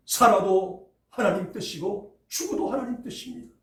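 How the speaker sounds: normal talking voice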